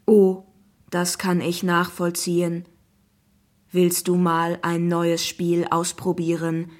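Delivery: slow, monotone computer pitch; friendly talking app. Acted. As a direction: present the game evenly, like a machine. Zeus app's voice. (langsame, monotone Computerstimme)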